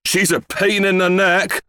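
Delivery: high, griping retort; exasperated complaint. A narrator's reading. Father complains about grandma.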